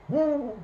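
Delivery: high, drawn-out hooting call; long, high owl call. A narrator's reading. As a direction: hoot out like a waking owl. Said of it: "she made the call owls make".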